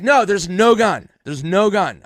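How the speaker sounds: loud, slow insistence; urgent denial. Retold or imitated. Teller calling off the scare, loud slow insistence. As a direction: shout them down calmly.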